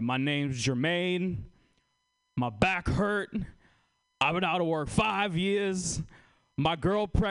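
slow, pleading pitch from a man on the train; hammed-up begging homeless man; mock sob story.

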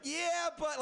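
mocking excuse.

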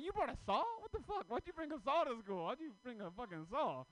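high, loud disbelieving yell; mock outrage, pitched high and loud; shocked yell. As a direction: shout it like you can't believe your eyes. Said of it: "I'm like".

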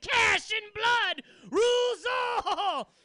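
slow, high, roaring battle cry; villain shout. Acted. Blood-soaked thimble declaring victory, slow high roar. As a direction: bellow it slowly like a conqueror.

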